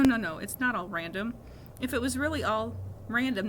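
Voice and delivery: ordinary delivery, nothing marked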